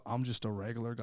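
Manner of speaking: low, soft deadpan excuse; John Light. Retold or imitated. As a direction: drop low and quiet, shrugging off all blame. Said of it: "He's like".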